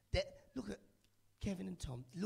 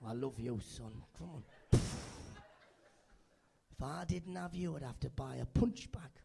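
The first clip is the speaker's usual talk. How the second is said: lightly voiced Sunderland dad; 4 semitones lower, 5 dB louder, much slower than usual. Dad voiced hitting son.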